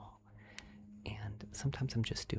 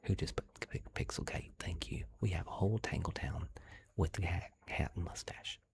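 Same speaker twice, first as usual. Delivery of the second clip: put-on southern accent. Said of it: "my southern accent".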